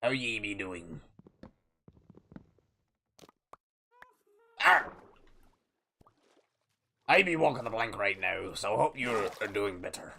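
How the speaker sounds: lightly voiced pirate talk; pirate captain. Pirate streamer narrating his boat build, lightly voiced pirate. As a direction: play a grumbling-along pirate builder.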